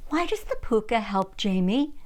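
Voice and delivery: ordinary delivery, nothing marked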